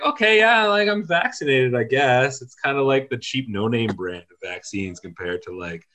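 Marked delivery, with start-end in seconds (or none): 0.0-2.4 meh. Acted. AstraZeneca personified, underwhelmed.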